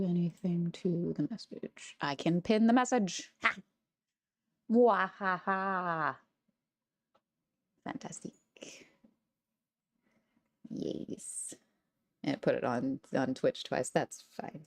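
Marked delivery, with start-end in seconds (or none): 3.4-6.2 slow evil cackle; mad scientist laugh. Acted. Gloating after pinning message. 7.8-11.5 breathy whisper. Real, not acted. Whispered satisfaction.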